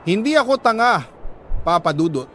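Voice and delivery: usual speaking voice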